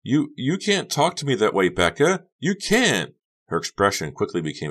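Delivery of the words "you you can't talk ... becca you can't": very high, loud, hurt protest; wounded protest. A narrator's reading. Honey cringing at Becca's words, wounded protest. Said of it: "a wounded expression twisting her features".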